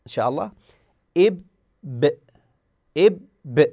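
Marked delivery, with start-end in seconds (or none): 1.2-3.6 إب then ب with a clear echo as the lips open; bounced b sound. Shown as a demonstration. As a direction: release the ب with an open bounce, not pressed. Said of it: "تلاحظون". (قلقلة الباء بانفتاح)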